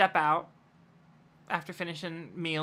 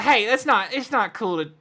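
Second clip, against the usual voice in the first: raised, loud voice of an intervening lawman; sheriff speaking up; 4 semitones higher, 6 dB louder than usual. Acted. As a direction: step in firmly as the sheriff. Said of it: "Sheriff Jackson speaks up".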